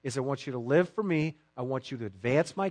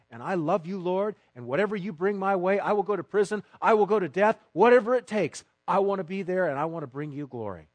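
bold vow; 5 semitones higher, 6 dB louder than usual. Acted. Peter's bold vow voiced.

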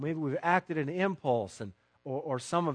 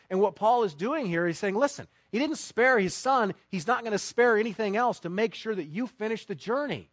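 ordinary talk

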